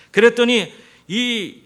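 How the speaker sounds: loud, slow, stretched 그랬더니; preacher drawing attention; emphatic. Real, not acted. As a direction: lean hard on 그랬더니 to build suspense. Building to the key point.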